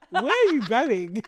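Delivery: slow, laughing question; laughing disbelief. Retold or imitated. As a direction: ask it through laughter. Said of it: "'Cause I'm just like".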